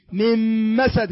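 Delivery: من مسد slow, like a double meem "mim-masad"; merged noon. Shown as a demonstration. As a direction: say من مسد as two meems. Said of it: "كأنك تنطق ميمين". (كأنك تنطق ميمين ببطء)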